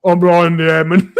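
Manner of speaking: slow, laughing dopey intro; same dopey tavern idiot, laughing through his name; silly idiot character. Retold or imitated. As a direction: announce yourself like a proud fool. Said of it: "I was like".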